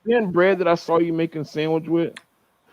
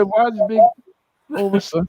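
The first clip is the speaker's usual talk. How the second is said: put-on Jamaican accent; 5 semitones higher than usual. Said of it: "say it in patois or Jamaican accent".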